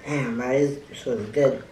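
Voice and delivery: talking while chewing food; muffled words around food; mouth full, chewing. Real, not acted. Seen 4: Bite taken just before; jaw working, mouth partly full while talking.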